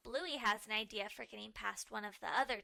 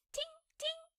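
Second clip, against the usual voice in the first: pretend shield sound.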